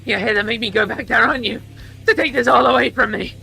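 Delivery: fast, loud accusation; angry accusation. Acted. Bucks accusing Iggy, fast and loud. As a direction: hurl the questions at her.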